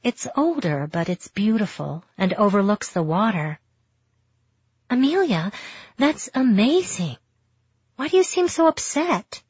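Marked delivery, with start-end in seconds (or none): 4.9-9.4 higher, bright excited friend voice. A narrator's reading. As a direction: gush like an eager friend.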